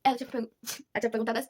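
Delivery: ordinary talk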